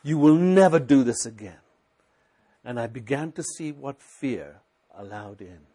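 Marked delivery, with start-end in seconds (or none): 0.0-1.6 retold angry rebuke. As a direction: drive the enemy off in anger. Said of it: "I got so angry".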